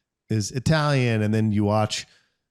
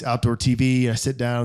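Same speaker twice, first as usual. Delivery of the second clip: ordinary talk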